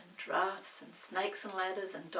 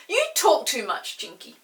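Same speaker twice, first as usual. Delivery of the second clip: crossly; 5 semitones higher, 15 dB louder than usual. Acted. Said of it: "said Peter crossly".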